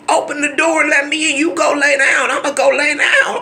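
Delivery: loud, high, impatient yelling; mock family shouting at the door; shouting through a door. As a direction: holler it through a closed door.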